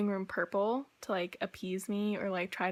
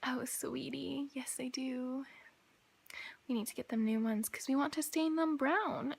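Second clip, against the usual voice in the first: hushed, patronizing "oh sweetie" voice set higher; condescending whisper; 4 semitones higher, 4 dB quieter than usual. Retold or imitated. Stepmoms dismissing her worries about the cabinets, patronizing whisper. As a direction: talk down to her in a breathy hush.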